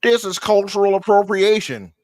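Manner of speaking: high, loud mock outrage; hammed-up indignant imitation; mocking idiotic complainer. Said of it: "people use the idiotic claim of".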